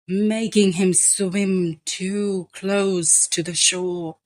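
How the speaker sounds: ordinary delivery, nothing marked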